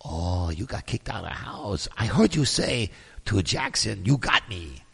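hushed, low imitation of the host; imitated show host. Host questioning the evicted housemate, hushed low impression. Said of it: "And she goes".

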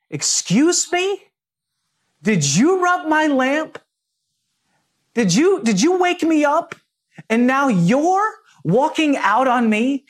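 high, indignant genie voice. Retold or imitated. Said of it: "the genie looks at him and he says".

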